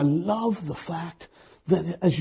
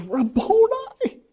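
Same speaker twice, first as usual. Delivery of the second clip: joyful recognition; 5 dB louder, slower than usual. Acted. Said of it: "she goes".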